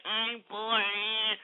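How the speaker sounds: soft drawn-out mock drone; mocking whiner. Host mimicking complaining listeners, mocking whine.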